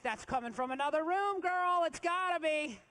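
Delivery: loud, high mock-panicked protest; insistent denial. Acted denial on learning of twins.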